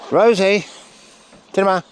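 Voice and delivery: high, loud shout of the dog's name; calling out. Real, not acted. Trainer shouting the dog's name across the field.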